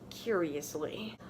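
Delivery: normal talking voice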